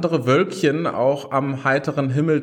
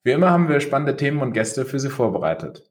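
fast legal disclaimer; 2 semitones lower, faster than usual. Acted. Recorded podcast intro disclaimer.

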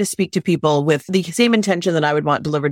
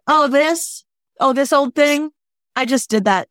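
higher, breezy, falsely modest; mock nonchalance; 6 semitones higher, slower than usual. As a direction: toss it off like it's nothing. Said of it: "trying to be like".

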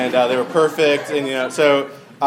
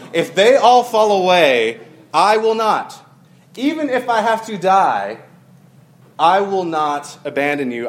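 boastful vow; 2 semitones higher, 4 dB louder than usual. Retold or imitated. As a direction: swear loyalty with cocky, emphatic weight. Acting out Peter's boastful vow.

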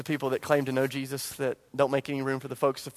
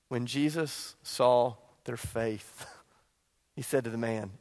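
slow, softer reprise of the line; soft reflective reprise; 5 semitones lower, 7 dB quieter, slower than usual. Acted. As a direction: savor it quietly for the church. Quiet slow reprise of verse.

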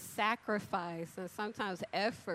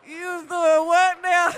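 slow, loud, high disbelief; incredulous shout; 8 semitones higher, 14 dB louder than usual. Retold or imitated. Retelling her shocked reply to the coworker, loud and high. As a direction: belt out your disbelief, slowly.

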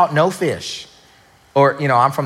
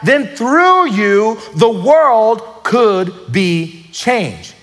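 higher and slower, building to a climax; emphatic repeat; 5 semitones higher, slower than usual. Acted. Preacher repeating the point to the congregation, emphatic. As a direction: drive it home slowly. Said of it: "let me just say that again for you".

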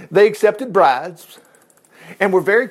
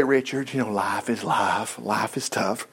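much lower and softer shrugging voice; mock objector; 9 semitones lower, 9 dB quieter, slower than usual. An imagined critic telling Richard that life is tough, low and soft. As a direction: play a shrugging naysayer.

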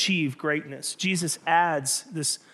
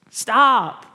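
much louder, higher second stop, a half-real yell; 5 semitones higher, 9 dB louder than usual. Retold or imitated. God yelling at Todd to stop, loud. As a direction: shout it to cut through. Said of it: "And God's just yelling".

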